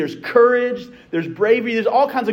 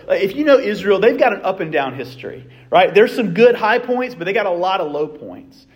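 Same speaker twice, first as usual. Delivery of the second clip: ordinary talk, nothing marked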